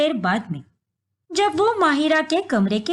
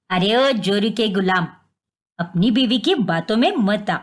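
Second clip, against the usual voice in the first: scornful mother-in-law; 5 semitones lower than usual. Acted. Mother-in-law accuses bahu.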